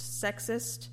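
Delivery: usual speaking voice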